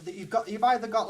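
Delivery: usual speaking voice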